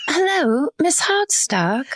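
polite British bearer of bad news; British accent. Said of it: "deliver bad news in a British accent".

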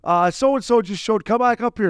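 high, loud call up the stairs, slow in the middle; wife yelling upstairs. Retold or imitated. Wife calling her husband back upstairs, loud high yell. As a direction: holler up from below like an impatient wife. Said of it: "his wife kept yelling up".

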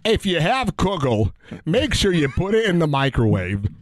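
a hammed-up Yiddish grandpa; Yiddish accent. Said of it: "a famous man once said".

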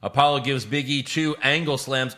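ordinary delivery

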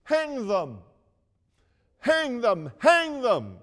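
rising mob cry, each one harder; an angry crowd yelling back, building; crowd shouting. Retold or imitated. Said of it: "the unmerciful crowd shouted back".

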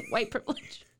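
laughing fake cough over words.

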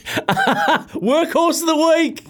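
high, drawn-out laughing announcement; dramatic announcer voice. Acted. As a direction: stretch it out like a hammy ringside announcer. Announcing the segment.

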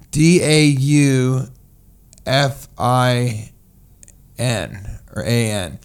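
slow letter by letter; slow deliberate spelling. Shown as a demonstration. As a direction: spell each letter with pauses. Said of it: "it's spelled".

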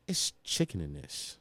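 very low, hushed, slow disbelief; retold disgust. Girlfriend looking into the plate, low and slow in imitated disgust. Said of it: "the look she gave me".

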